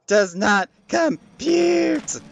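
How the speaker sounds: high, slow, stretched robot wail; malfunctioning robot shout. Acted. Machine voice escalates.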